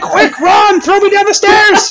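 very high squealing voice; frantic comic shriek, laughing; mock panic. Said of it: "You're like".

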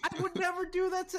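high, startled protest; shocked denial. Real, not acted. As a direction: deny it in disbelief. Denying the accusation, pitched up.

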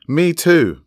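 high, loud and eager; excited call. A narrator's reading. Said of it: "called Lobelia excitedly".

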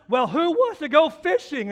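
cheery grandpa. Retold or imitated. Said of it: "Grandpa says".

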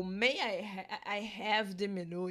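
slow, careful and courteous; polite formal request. Shown as a demonstration. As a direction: ask as politely as you can.

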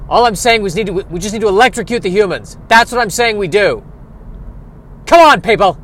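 loud, high, worked-up rant; rally the crowd like an angry agitator; ranting eel. Acted.

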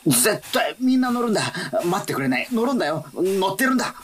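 excited urging. Acted.

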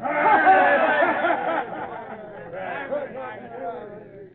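drawn-out gloating cackle; long evil laugh. Acted. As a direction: cackle like a greedy pirate.

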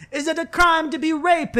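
very high, put-on hysterical voice; hysterical roommate. Roommate imitating her outburst at police, hysterical. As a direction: mimic her outcry far above your own pitch.

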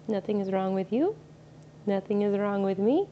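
normal talking voice

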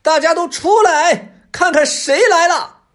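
very high, drawn-out announcing yell; triumphant fox hollering, long high vowels; loud proud shout. A narrator's reading. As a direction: call out to the whole forest. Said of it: "一边走着一边大声喊".

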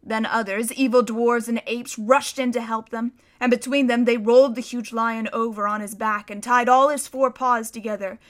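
ordinary delivery

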